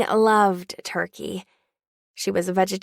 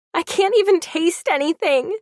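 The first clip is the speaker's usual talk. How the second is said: high, loud anguished scream; a dying mother screaming in frustration; 8 semitones higher, 4 dB louder than usual. Acted. Mother screams at grandma over the food, high and loud. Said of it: "my mother screamed".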